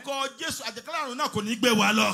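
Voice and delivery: loud, fast prayer declarations; fiery preaching prayer. Real, not acted. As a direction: pray it out over the church with force.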